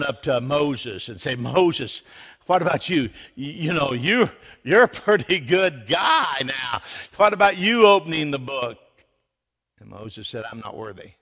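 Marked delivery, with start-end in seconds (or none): 1.4-8.8 mildly hammed-up pleading, getting louder; eager appeal. Preacher as searching angels asking Moses, eager mock appeal. 10.5-11.1 much softer, lower acted reply; humble refusal. Preacher as Moses declining the angels, humble hushed refusal.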